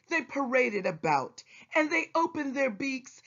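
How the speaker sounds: ordinary delivery, nothing marked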